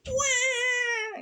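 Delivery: put-on baby ghost wail. A narrator's reading. Baby ghost alarmed by the shouting wails. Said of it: "yelled Baby Ghost".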